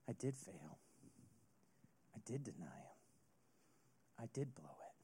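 soft, broken whisper of guilt; hushed confession. Acted. Peter admitting to himself he blew it, whispered. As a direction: let it fall to a whisper, owning the failure.

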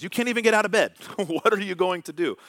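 wife laughing at him, high and loud; wife's worried voice. Retold or imitated. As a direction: play his panicking wife, half laughing. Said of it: "She goes".

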